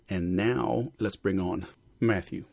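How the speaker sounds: ordinary delivery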